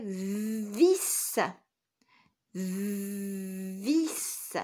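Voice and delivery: vis with a long buzzing v; dictation sounding-out. Shown as a demonstration. Teacher giving pupils a new word, vis drawn out sound by sound. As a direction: dictate vis slowly, stretching the v and the final s.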